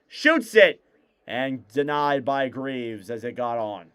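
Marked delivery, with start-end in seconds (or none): 0.1-0.8 higher, louder sudden shot call; excited call. Acted. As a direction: jump on the shot.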